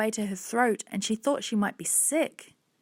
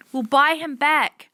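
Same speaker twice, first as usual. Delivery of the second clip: high, loud, slow offer; emphatic, high-pitched plea; insistent child; 5 semitones higher, 7 dB louder, slower than usual. A narrator's reading. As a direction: insist in a girl's raised voice. Hannah makes desperate offer.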